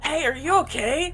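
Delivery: a hint of a put-on character voice; Komida. Player doing their best impression for the group, lightly put-on voice. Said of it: "That's my best Komida impression".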